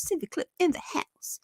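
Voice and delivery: soft, whispery repeat of a greeting; whispered echo. Pretend. Streamer echoing a viewer shout-out, whispered. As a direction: echo it under your breath in a whisper.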